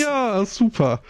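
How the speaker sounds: put-on high, slow toddler praise; praising a toddler. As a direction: cheer a small child on slowly.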